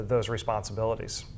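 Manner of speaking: normal talking voice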